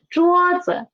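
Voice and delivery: teacher's model 桌子, 子 falling below a high 桌. Shown as a demonstration. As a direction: say 桌子 high first, then drop light 子. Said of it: "the pitch of 子 is lower than 桌".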